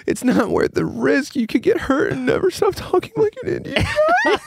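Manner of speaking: high-pitched mock pleading, breaking into laughter; imitated crying wife. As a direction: play a worried wife's plea, half laughing. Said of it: "My wife is crying. She said".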